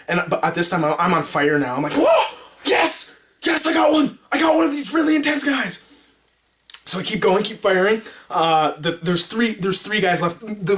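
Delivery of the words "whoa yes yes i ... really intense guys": excited shout pitched way up; triumphant cheer. Retold or imitated. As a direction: celebrate the hit, yelling. On fire, hit one.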